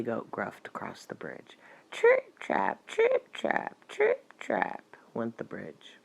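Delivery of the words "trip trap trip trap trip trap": very high, light trip-trap; tiny hoof sounds. A narrator's reading. Storyteller voices the youngest goat crossing the bridge, tiny and high. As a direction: sound out little hooves, very high. Second goat's hooves on bridge.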